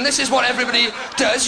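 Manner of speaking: ordinary delivery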